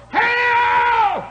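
high ringing mock holler of hell. Old mountain preacher running down the aisle, hollered. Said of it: "run up down the aisle and holler".

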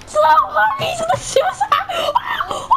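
high, laughing excited yell; excited shout. Real, not acted. Excited game moment.

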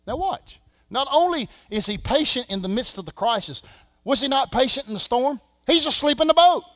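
preaching at full force. Acted. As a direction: whip the crowd up, slowing on tied up. Preacher rising to a shout.

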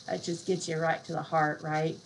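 ordinary delivery

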